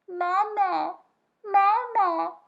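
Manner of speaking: high, loud little chick voice calling out; a baby goose peeping from inside its egg; tiny peeping gosling. A narrator's reading. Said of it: "peeped a tiny, muffled voice".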